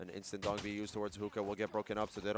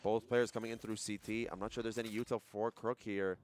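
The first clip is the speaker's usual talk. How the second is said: normal talking voice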